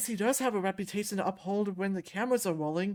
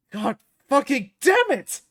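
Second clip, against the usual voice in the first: loud, higher, drawn-out cursing; furious shout; 5 semitones higher, 10 dB louder than usual. Acted. Fighter who just broke the controller, furious. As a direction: explode in anger, hammering each word slowly.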